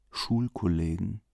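soft, brooding repeat of Schulkollegen; quiet echo. A narrator's reading. Nervous Leo alone at night, repeating it softly. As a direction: murmur Schulkollegen to yourself, uneasy.

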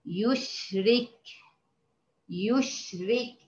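soft slow model of يشرك; tajweed demo word. Shown as a demonstration. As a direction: ease out يشرك slowly, the ra kept thin. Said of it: "أو كلمة".

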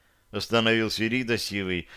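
ordinary delivery

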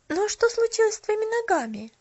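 put-on high, eager young girl; girl's voice. A narrator's reading. Girl asking the shopgirl, high and curious. Pollyanna's voice in story.

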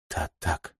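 very soft, faint reply; barely audible. A narrator's reading. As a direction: say it almost under your breath. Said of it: "проговорила Бланка едва слышно". (едва слышно)

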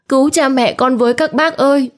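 put-on girl screaming to the onlookers; rising desperate scream. A narrator's reading. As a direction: beg the neighbours at the top of her voice. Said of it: "Nó hét to".

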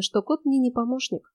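plain reading voice

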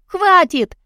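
high, loud cry of protest; high-pitched shout; full-lunged yell. A narrator's reading. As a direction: yell it out to stop him. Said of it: "завопила я полными лёгкими".

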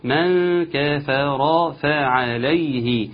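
very slow drawn-out recitation. Shown as a demonstration. Teacher modelling the verse, very slow. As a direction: give every vowel its full length. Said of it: "ننصح بعض في إيه هنا".

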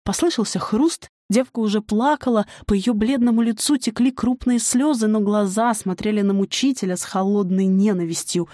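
ordinary delivery

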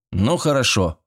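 ordinary delivery

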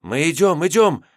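high, rising scared assurance; frightened. A narrator's reading. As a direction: sound terrified, eager. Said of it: "перепуганно".

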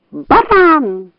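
high indignant fox yelp; high-pitched insult; fox's outcry. A narrator's reading. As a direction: cry it out high, stung. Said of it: "вскрикнула лиса".

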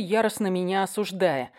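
plain reading voice

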